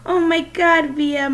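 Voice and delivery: loud, high thrilled cry; screaming with excitement. A narrator's reading. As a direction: squeal it out in delight. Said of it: "Mom was screaming with excitement".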